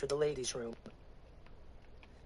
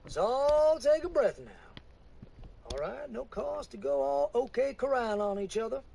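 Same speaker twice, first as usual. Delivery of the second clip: a lightly voiced preacher, higher, soothing her down; calming preacher; 10 semitones higher, 4 dB louder than usual. Preacher calming the armed woman, lightly voiced.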